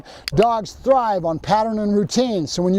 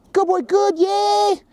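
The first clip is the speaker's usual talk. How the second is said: very high, singsong cheer for a dog; high-pitched dog praise; 13 semitones higher, much slower than usual. Real, not acted. Trainer rewarding Loki in training, very high cheering praise. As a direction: squeal the praise to reward him.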